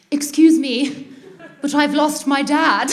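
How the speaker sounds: exaggeratedly posh British English; posh British accent. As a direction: put on your poshest British accent, slowing at the end. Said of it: "poshest British accent".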